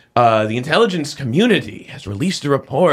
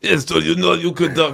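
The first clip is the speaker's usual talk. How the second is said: put-on Russian accent, pitched up; mock Russian accent; 4 semitones higher than usual. Host jokes to co-hosts in a mock Russian accent.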